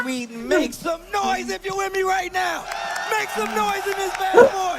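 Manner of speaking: high, slow shout to the crowd; hype shout. Real, not acted. Host rallying the Swedish crowd, high and loud. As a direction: pump up the arena.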